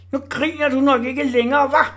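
high, loud jeering howl; howling shout. A narrator's reading. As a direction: howl the taunt up from below. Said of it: "hylede Orla".

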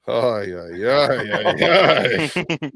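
comic exasperation at a big mess; exasperated God. Acted. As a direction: groan out a playful lament, breaking into laughter. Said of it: "I feel like the Father goes".

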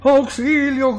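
high, loud alarmed call; cry for help. A narrator's reading. Coloured shapes fleeing a monster, alarmed cry. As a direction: call out in storybook panic. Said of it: "gritan las figuras de colores".

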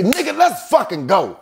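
high, exasperated retold outburst; angry. Finally mad at James and urging him out, angry. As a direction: push it out high and fed up. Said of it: "till finally I get angry".

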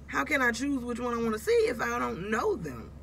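exasperated retelling. Retold or imitated. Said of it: "I'm like".

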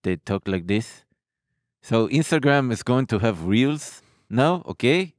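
mock Israeli accent with drawn-out "eh" fillers. Shown as a demonstration. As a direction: impersonate an Israeli speaker with a lisp. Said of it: "the Israeli accent is a lot like this".

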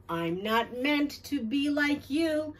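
usual speaking voice

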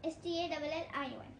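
rapid letter by letter spelling. Real, not acted. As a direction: rattle off each letter fast. Spelling bee answer. (तेज़ी से अक्षर-अक्षर spelling)

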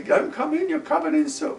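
very high, loud frantic order; mother shouting. Retold or imitated. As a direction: shriek to keep him out of the shelter. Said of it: "she's telling me".

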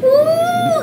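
high squealing laugh into a woo; giddy high cackle and whoop; delighted laughing whoop. Real, not acted. Laughing at pickup line.

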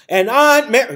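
loud, high sudden yell. Shown as a demonstration. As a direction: blurt it out at full volume into the silence. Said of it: "you hear yourself yelling".

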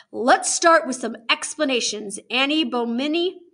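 stern official opening an interrogation; stern warden. Acted. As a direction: confront a child with authority. Warden begins questioning the children.

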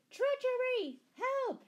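high, full-voiced yell for help; alarmed shout. A narrator's reading. Sheriff seeing his prisoner armed, alarmed shout. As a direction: shout the alarm as loud as you can. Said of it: "shouted the sheriff".

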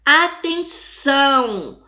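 "Atenção" slowly broken up, final "ção" held long; stressed last syllable. Shown as a demonstration. Teacher quizzing class on tonic syllable, "ção" stressed in "Atenção". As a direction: stretch "Atenção" and lean on "ção". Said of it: "Qual que é a sílaba tônica?".

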